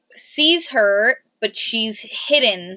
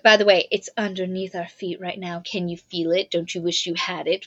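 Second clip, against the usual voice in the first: soft, low, tempting villain; sinister villain whisper; 5 semitones lower, 5 dB quieter than usual. Retold or imitated. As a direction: tempt them quietly like a villain. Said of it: "he's like".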